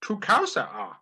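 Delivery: high croaky little Yoda voice. Retold or imitated. Telling the joke as Yoda, high and croaky. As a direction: speak as the wise little Jedi.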